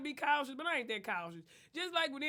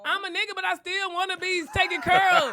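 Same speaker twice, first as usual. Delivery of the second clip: shouted, high-pitched comic protest; raised voice, playfully indignant; loud protest; 5 semitones higher, 11 dB louder than usual. Real, not acted. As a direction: holler it out over the teasing. Mock protest after being teased.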